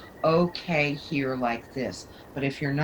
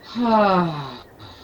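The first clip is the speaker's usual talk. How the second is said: release sigh; 2 semitones higher, 4 dB louder than usual. Shown as a demonstration. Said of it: "Let's take a breath".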